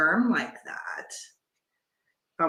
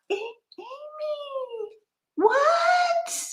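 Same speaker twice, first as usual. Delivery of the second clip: very high, breath-caught astonishment; stunned disbelief; much slower than usual. Real, not acted. Gift recipient to her friend, stunned disbelief. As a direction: squeak it out slowly, truly stunned.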